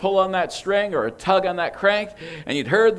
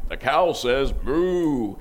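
slow, drawn-out toy recording voice; talking toy voice; 5 semitones lower, 3 dB quieter, much slower than usual. Retold or imitated. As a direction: read it like a slow pull-string toy. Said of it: "it would go".

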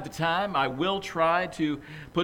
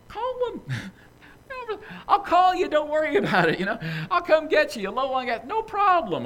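eager phone call; 12 semitones higher, 5 dB louder than usual. Acted. Pastor acts out phoning a friend.

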